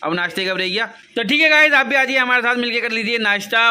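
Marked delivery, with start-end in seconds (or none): 1.2-3.7 raised, higher voice; loud, high-pitched invitation; loud calling out. Real, not acted. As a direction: call everyone over to eat, loudly. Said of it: "मैं बहुत ज़ोर ज़ोर से चिल्ला रहा हूँ".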